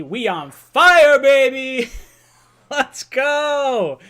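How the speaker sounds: loud, high hype yell; excited shout. Real, not acted. Fan host celebrating the new coach hire, excited shout. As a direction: whoop it out, stretching the words long.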